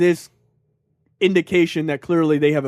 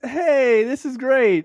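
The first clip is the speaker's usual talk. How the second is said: mock cheer; 6 semitones higher, much slower than usual. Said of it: "it was like".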